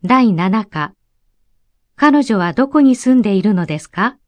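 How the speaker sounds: ordinary delivery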